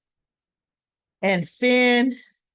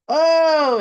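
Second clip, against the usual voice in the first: imitating a sob; 5 semitones higher, 5 dB louder than usual. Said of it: "and he's crying".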